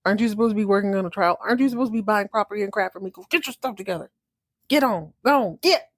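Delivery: fast, impatient scolding; bossy shooing. Retold or imitated. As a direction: hurry him off. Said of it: "She's like".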